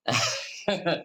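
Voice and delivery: brief spontaneous chuckle; real laugh. Real, not acted. Preacher amused by imagining the listeners' thoughts, real chuckle. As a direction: break into a short laugh. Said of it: "எதுக்காக இந்த சிரிப்பு வந்தது".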